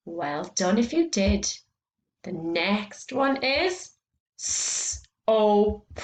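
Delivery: ordinary talk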